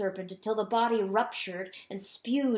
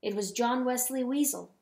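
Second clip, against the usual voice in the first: plain reading voice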